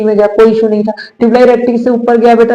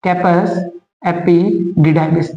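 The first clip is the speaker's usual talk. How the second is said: very slow term; 3 semitones lower, 4 dB quieter, much slower than usual. Shown as a demonstration. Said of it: "इसका पूरा नाम है".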